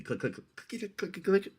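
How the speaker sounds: soft rapid mouth clicks; quiet insect clicking noises; imitated bug clicking. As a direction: chitter like an attacking bug. Imitating the bug's attack noises.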